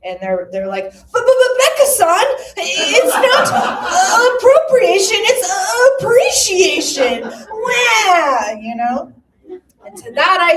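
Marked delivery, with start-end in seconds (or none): 0.9-8.5 high, loud whining mock anime voice; hammed-up crying protest; whiny protest. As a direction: whine like a wounded fan. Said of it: "their collective voice in protest".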